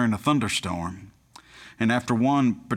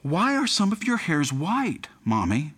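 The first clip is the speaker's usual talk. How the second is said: inquisitive; 5 semitones higher than usual. Retold or imitated. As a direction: imitate a curious child asking Mommy. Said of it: "looked at her mother inquisitively".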